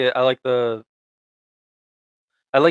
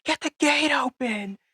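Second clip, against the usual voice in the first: mock yelling. Podcaster imitating stupid characters yelling at each other, mock yelling. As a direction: imitate panicked characters yelling, held back in volume. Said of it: "yelling at each other".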